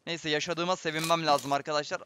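ordinary talk, nothing marked

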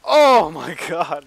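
loud, slow laughing oh my God; laughing shock. Real, not acted. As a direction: burst out laughing loudly. Just killed, caught off guard.

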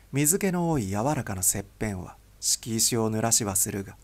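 usual speaking voice

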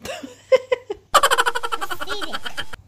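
high burst of laughter. Real, not acted. Laughs at child's drawing.

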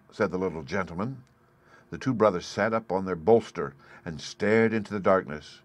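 ordinary delivery, nothing marked

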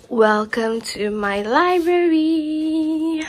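mock-grand presenter voice; grand welcome. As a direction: unveil the room like a proud guide. Presenting her library to viewers.

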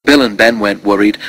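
ordinary delivery

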